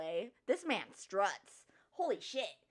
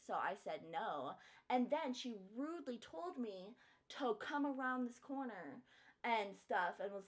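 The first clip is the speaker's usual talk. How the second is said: ordinary delivery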